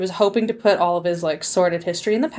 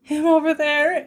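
meek; 7 semitones higher than usual. Retold or imitated. Retells own timid answer.

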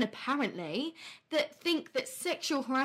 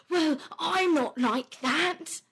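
slow, high, hammed-up whiny protest; mock defensive man; 6 semitones higher, 4 dB louder, slower than usual. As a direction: parody a huffy man defending himself. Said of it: "come back with a".

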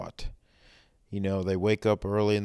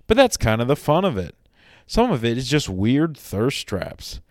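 higher, animated promo announcer read; mock ad read; 4 semitones higher, 7 dB louder than usual. Host plugging Andrew Burge's Instagram, mock ad read. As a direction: sell it like an over-the-top podcast ad, swooping pitch.

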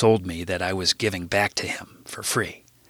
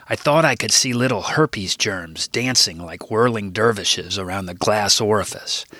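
ordinary talk

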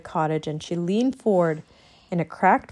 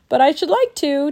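higher, louder, with a grin; grinning eagerness; 7 semitones higher, 5 dB louder than usual. A narrator's reading. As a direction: say it with cheeky relish. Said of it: "Teddy said with a grin".

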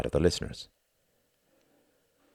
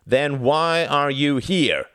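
slow, loud, high demand; 9 semitones higher, 5 dB louder, much slower than usual. Acted. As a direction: hammer each word home. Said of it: "demanded a Nazi".

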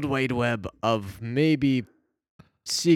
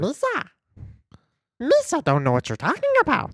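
much higher, fast alien voice; Jar Jar Binks; 12 semitones higher than usual. Pretend. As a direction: squeak it out as a goofy creature. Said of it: "Do Jar Jar Binks".

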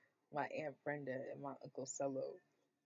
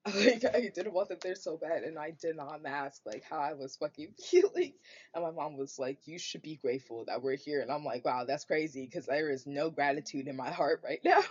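much louder, laughing in disbelief; loud laughing rant about her mom; 10 dB louder than usual. Real, not acted. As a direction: vent loudly, half laughing at it. Ranting, laughing, quoting mom.

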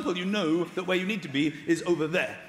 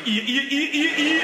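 loud, high string of Geordie vowels; Geordie accent; 7 semitones higher, 5 dB louder than usual. Retold or imitated. Geordie reciting the vowels, loud mock Geordie accent.

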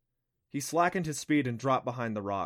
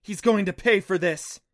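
loud, raised, furious threat; angry vow; 7 semitones higher, 8 dB louder than usual. A narrator's reading. Clancy finding bones of the Abata, angry. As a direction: vow revenge loudly and hard. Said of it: "She looked angrier than he knew".

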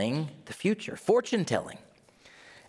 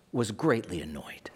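1 whisper; 4 semitones lower, 3 dB quieter than usual. Shown as a demonstration. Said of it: "reading verse as whisper".

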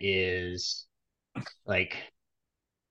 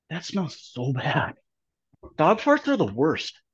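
smelling something awful; 6 semitones higher than usual. Real, not acted. Still smelling the fart.

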